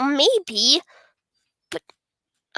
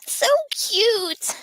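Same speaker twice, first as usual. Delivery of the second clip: put-on cute girl voice; 10 semitones higher than usual. Girl character voiced in game.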